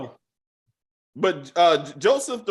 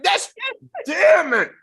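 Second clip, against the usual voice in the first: high, loud outraged yell at the show; high exasperated shouting; 9 semitones higher, 4 dB louder than usual. Real, not acted. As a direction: shout your frustration at the writers. Outraged at the show's plot.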